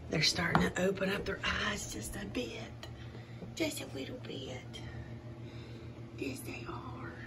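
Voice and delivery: hushed whisper so the pups stay calm; soft whisper. Real, not acted. Owner whispering to newborn pups, soft whisper. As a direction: breathe the words softly.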